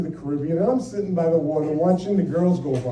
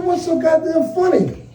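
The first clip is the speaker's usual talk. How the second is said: higher, indignant; husband hurt at being laughed at; mock annoyed; 9 semitones higher than usual. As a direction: bristle at the laughter. Annoyed husband in cupid suit.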